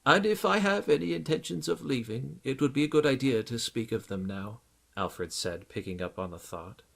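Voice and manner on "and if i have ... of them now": higher, proper butler defending his master; a touch of the loyal English butler; lightly voiced butler. Alfred guesses the doctor's thought.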